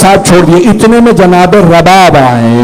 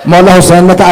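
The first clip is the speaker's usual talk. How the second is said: ordinary talk, nothing marked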